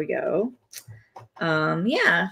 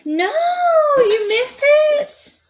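teasing singsong; 5 dB louder, much slower than usual. Pretend. Teasing viewer over missed moment.

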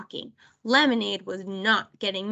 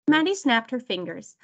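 plain reading voice

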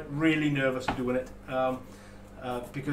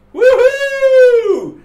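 very high, very loud cheer; excited whoop; 17 dB louder than usual. Real, not acted. Announcing the muds are live, loud joyful whoop. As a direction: let out a big celebratory whoop. Celebrating product going live.

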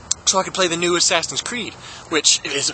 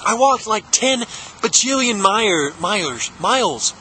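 normal talking voice

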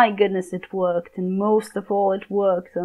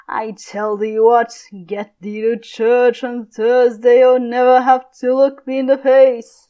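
louder furious threats; furious Capulet; 3 semitones higher, 4 dB louder, slower than usual. Acted. Father threatening his daughter, louder furious shout. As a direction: roar the threat at her.

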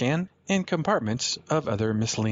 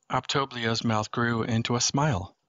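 ordinary delivery, nothing marked

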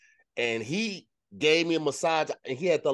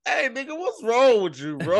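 mock shout; 8 semitones higher, 6 dB louder than usual. Acted outburst at mustache.

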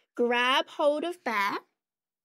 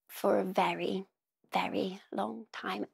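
whisper; 3 semitones lower, 6 dB quieter than usual. Pretend. Storytelling that time has passed.

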